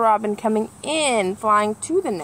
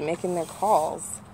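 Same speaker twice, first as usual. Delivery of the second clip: plain reading voice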